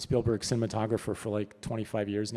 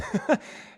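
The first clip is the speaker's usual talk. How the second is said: high breathy laugh; 13 semitones higher than usual. Real, not acted. After getting his break.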